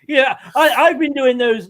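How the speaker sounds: laughing through the words. Real, not acted. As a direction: giggle while you say it. Laughs at own joke.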